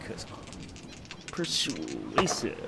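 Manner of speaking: low, slow, drawn-out "persuasive"; a joking low drawl on "persuasive"; mock persuasive. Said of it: "I'm not persuasive".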